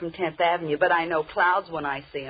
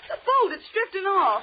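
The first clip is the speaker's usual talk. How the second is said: alarmed cry; 12 semitones higher than usual. Acted. Boat drifting away, alarm.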